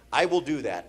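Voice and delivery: usual speaking voice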